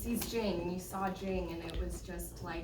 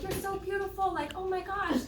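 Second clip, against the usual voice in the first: high, excited gushing; imitating her thrilled, gushy greeting; gushing aunt; 11 semitones higher, 3 dB louder than usual. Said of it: "She was like".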